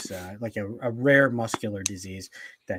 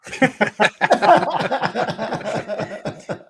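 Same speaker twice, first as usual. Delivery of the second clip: long, loud, high burst of laughter; 11 semitones higher, 8 dB louder than usual. Real, not acted. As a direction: crack up at the punchline. Big laugh at the punchline.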